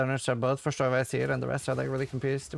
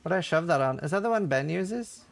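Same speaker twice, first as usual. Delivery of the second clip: ordinary delivery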